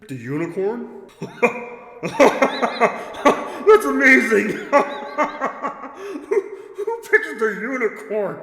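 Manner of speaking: booming laughing undead king; laughing creature. Acted. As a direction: laugh big and slow at the choice. Creature laughing at unicorn pick.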